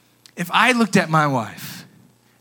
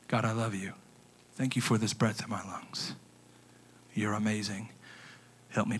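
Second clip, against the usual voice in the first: lower, soft, hushed prayer; softly whispered intimate prayer; 9 semitones lower, 12 dB quieter than usual. Shown as a demonstration. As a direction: breathe it out like a quiet morning prayer. Demonstrating a quiet morning prayer.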